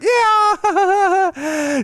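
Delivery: high, drawn-out mock yeah; smug stripper. Stripper answering the customer, high smug drawn yeah.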